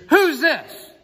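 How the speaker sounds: high, loud mock phone yell; shouting down the phone. Caller on a bad line in a storm, shouting. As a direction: imitate a caller yelling over bad reception. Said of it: "it's difficult reception".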